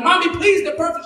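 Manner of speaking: put-on high-pitched pleading child; spazzing kid. Twelve-year-old begging mom for glasses, high pleading. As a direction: beg Mommy like a desperate kid. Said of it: "I started spazzing".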